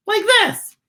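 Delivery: very high little worm voice; squeaky worm. Acted. Worm showing its wiggle, very high squeak. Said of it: "says Worm".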